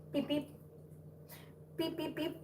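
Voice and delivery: tiny, high, soft beeps; little squeaked beeps to a kitten; playful beeping. Real, not acted.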